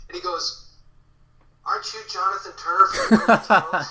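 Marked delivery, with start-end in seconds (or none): 1.6-3.8 louder, higher, laughing question; a touch of a starstruck stranger; sudden recognition. Retold or imitated. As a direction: lightly act out the man's delighted realization. Man suddenly recognizing him.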